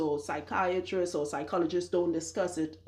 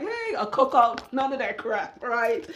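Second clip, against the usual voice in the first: mocking picnic-goer; 4 semitones higher than usual.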